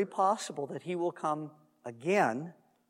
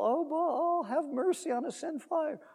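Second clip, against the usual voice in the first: mock plea to Baal; 9 semitones higher than usual. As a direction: imitate worshippers calling on their god. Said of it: "they're calling on Baal".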